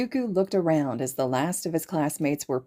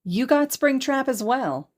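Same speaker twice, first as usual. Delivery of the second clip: excited question; 9 semitones higher, 3 dB louder than usual. Acted. Hagakure asks excitedly.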